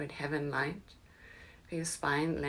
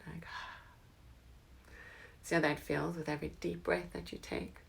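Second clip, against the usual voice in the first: soft spa whisper; 4 dB quieter than usual. Acted. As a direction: sigh then whisper. Sigh, then whispered cue.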